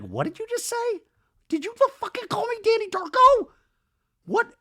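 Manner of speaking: very high screechy indignant yell; hammed-up outrage. Danny reacts to being called Danny Darko, mock outrage.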